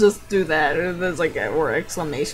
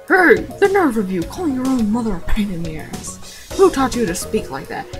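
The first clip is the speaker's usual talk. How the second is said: scolding mom voice; 3 semitones higher than usual. Acted. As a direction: scold him like a fussy mom. Mother scolding son.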